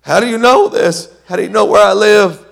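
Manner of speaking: very high, loud disbelieving cry; astonished shout. Retold or imitated. Charlie stunned that Tony found his trailer. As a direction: shriek it in shock. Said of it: "Charlie looks at him and says".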